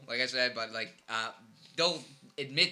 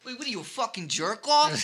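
high, loud Jersey insult; hammed-up Jersey indignation; Jersey accent; 8 semitones higher, 5 dB louder than usual. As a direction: heckle in a thick Jersey accent. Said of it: "we're from Jersey".

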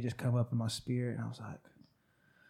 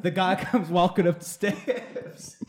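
laughing, high, loud and slow; 7 semitones higher, 6 dB louder, slower than usual. Real, not acted. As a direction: laugh through the punchline. Laughing at irony mid-story.

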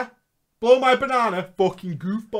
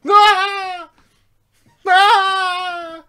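high, loud mock scream of fright; hammed-up high-pitched "aah"; imitated yell; 12 dB louder than usual. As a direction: let out a long, startled yell. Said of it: "he would yell into TeamSpeak".

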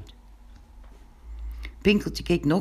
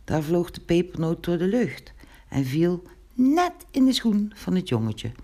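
ordinary delivery

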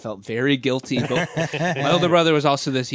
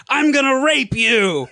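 loud, high, slow mock yell; retold shout; 12 semitones higher, 6 dB louder than usual. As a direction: bellow it through the door to scare them. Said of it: "and shouts".

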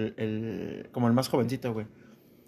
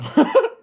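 high laugh bursting out; burst of laughter; 7 dB louder than usual. Real, not acted. Admitting his hand hurt from the punch, laughing loudly. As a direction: laugh hard and loud.

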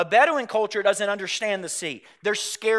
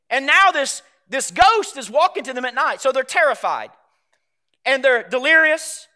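louder, higher sermon delivery; preaching at full force; 8 semitones higher, 9 dB louder than usual. Acted. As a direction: preach it with full conviction to the congregation. Full-force preaching on Jesus walking on water.

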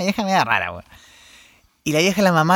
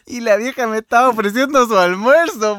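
normal talking voice

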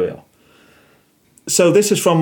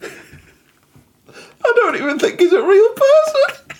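high, crying with laughter; laughing hard; slower than usual. Real, not acted. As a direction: squeal it out through laughter. Laughing fit about Pizza Liam.

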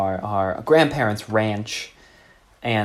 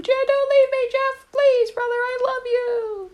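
very high, mock-weepy pleading; little boy begging. Little Johnny begging brother Jeff not to leave him, very high and mock-weepy. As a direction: wail after your departing brother. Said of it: "he was going".

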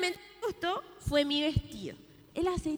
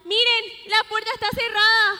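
alarmed shout; 5 semitones higher, 11 dB louder than usual. Acted. Girls find door shut.

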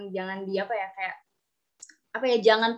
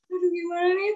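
anxious retold worry; 7 semitones higher than usual. Student recalls dreading others' expectations of her English, anxious. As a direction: voice the nervous inner panic, pitched up.

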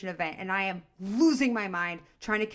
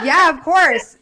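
laughing yes; 6 semitones higher, 15 dB louder, slower than usual. Real, not acted. Laughing reply to host's request.

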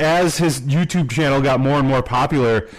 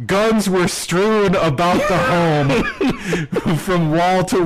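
laughing while quoting; 6 semitones higher, slower than usual. Retold or imitated. Reading court documents, amused.

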